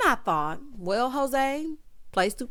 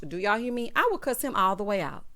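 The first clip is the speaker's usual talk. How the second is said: ordinary talk, nothing marked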